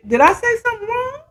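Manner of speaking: high, fast anxious question; worried question. Retold or imitated. Alicia asking if she said something wrong, high and quick.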